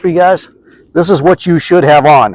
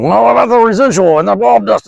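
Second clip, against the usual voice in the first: high, mocking voice of a fretful customer; worried viewer; 8 semitones higher than usual. Pest guy mimics viewers fretting over residual in a high mock voice. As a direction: squeak it out like a panicky viewer. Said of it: "I want you guys freaking out on me going".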